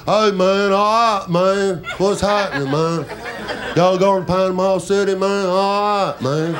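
northern Florida stoner drawl; pothead northern Florida accent. Acted. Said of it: "kind of the pothead thing".

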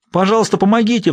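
high-pitched desperate plea; imploring woman's cry; pleading. A narrator's reading. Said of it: "взмолилась Лайла".

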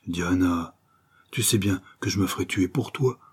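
ordinary delivery

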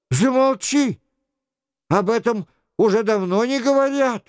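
high, agitated hushing; indignant king. Acted. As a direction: order silence as a flustered king.

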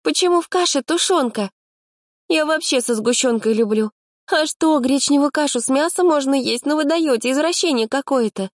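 put-on high, indignant girlish voice; a fussy girl outraged at the porridge.

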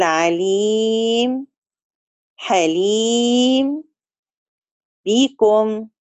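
عليم، حليم drawn out very slowly, final ميم closed with no nasal hum; slow recitation demo. Shown as a demonstration. Quran teacher showing students the stop, very slow. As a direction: stretch each word slowly and stop dead on the ميم. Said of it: "بقول".

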